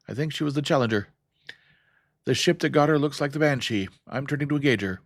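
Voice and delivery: put-on high, choppy Beowulf accent, loud; staccato Beowulf accent. A narrator's reading. Captain reporting a lost ship, in a loud staccato Beowulf accent. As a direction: bite off each word in a Beowulf accent. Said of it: "in his staccato Beowulf accent".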